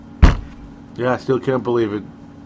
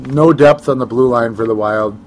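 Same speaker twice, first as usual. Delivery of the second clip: ordinary talk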